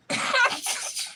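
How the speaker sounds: high unvoiced burst of laughter. Real, not acted. Laugh burst after story.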